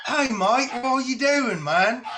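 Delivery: a friend's casual hello, lightly put on; imitating Kev. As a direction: mimic your friend's greeting, teasing. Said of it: "He talks like this".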